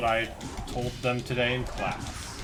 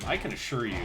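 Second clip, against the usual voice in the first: ordinary talk, nothing marked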